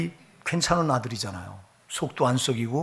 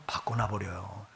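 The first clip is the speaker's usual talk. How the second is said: hushed punchline; 5 semitones lower, 11 dB quieter than usual. Acted.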